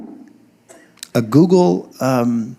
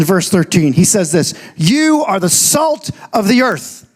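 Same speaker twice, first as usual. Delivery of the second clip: higher-pitched, emphatic preaching; 7 semitones higher than usual. Real, not acted. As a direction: preach it with conviction to the congregation.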